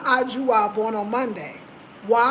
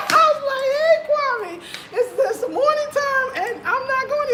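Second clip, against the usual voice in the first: high, laughing protest; high giggling refusal; 13 semitones higher than usual. Real, not acted. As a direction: squeal it out through laughter. Inner thoughts, laughing.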